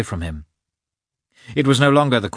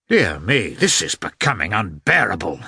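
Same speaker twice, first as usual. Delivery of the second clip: ordinary delivery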